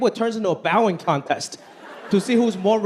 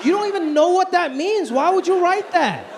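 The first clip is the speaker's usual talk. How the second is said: exasperated; 9 semitones higher than usual. Retold or imitated. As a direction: yell at your dad in disbelief. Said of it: "I'm like".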